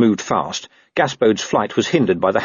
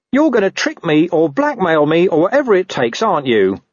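higher-pitched, exasperated dog; accusing talking dog; 10 semitones higher, 3 dB louder than usual. Acted. Dog foreseeing trickery from watchman, exasperated and high. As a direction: grumble the accusation in a raised voice.

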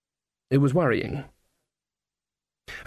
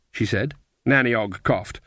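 ordinary delivery, nothing marked

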